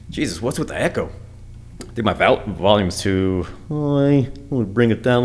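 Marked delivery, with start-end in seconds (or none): none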